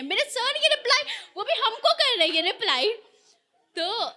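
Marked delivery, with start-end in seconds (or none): none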